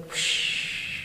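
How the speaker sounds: unvoiced breathy whoosh exhale; swish sound. Shown as a demonstration. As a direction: blow out a long airy whoosh. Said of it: "making the whoosh again".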